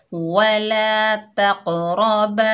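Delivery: slow, drawn-out Quranic recitation. Shown as a demonstration. Teacher reciting the word for pupils, slow chanted recitation. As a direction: recite it slowly, like tilawat. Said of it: "बा मिलाकर कैसे पढ़ेंगे".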